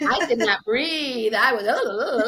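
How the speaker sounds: comic out-of-breath babble; breathless fast-talker, imitated. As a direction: act out talking without breathing. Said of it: "the fast talking".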